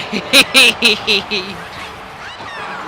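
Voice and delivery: long, trailing laugh of disbelief; helpless baffled laughing; incredulous laugh. Real, not acted.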